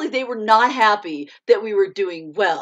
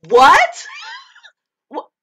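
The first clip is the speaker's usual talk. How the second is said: loud, much higher laughing what; shocked disbelief; 11 dB louder, much slower than usual. Retold or imitated. The team hearing they won, loud and shocked. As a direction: burst out in stunned surprise. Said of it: "And we all went".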